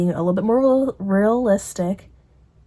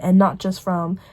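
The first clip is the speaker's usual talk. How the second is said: ordinary delivery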